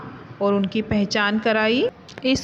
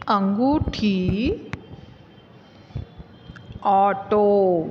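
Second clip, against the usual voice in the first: slow, clear, one word at a time; word list recital; slower than usual. Shown as a demonstration. Teacher reading vocabulary aloud to students, slow and clear. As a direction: read each word out for the class to repeat.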